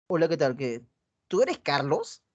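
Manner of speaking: low, flat "¿tú eres Carlos?" with no interest; uninterested. Shown as a demonstration. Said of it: "Un mismo mensaje dicho de diferente manera". (chica desinteresada, pregunta con desgano)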